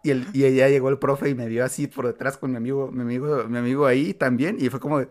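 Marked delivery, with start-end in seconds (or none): none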